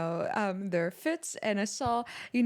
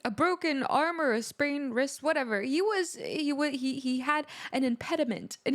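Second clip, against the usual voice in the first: higher, animated praise; excited gushing; 6 semitones higher than usual. Real, not acted. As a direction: rave about it, barely holding in the excitement. Gushing over the keyboard player.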